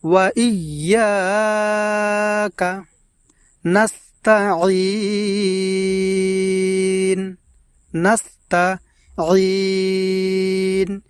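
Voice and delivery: ওয়া ইয়াকা নাস্তাঈন with held ইয়া and ঈন; long vowels. Shown as a demonstration. Teacher reciting for viewers, stretched vowels. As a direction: recite slowly, holding the vowels. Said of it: "লম্বা উচ্চারণে টান দিয়ে পড়ব".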